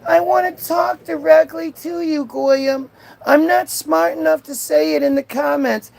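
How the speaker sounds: high-pitched, hammed-up pleading; mock begging. Streamer mocks a heckler who wants to talk, mock begging. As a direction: imitate someone begging for attention. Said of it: "begging the Goyim to talk to you".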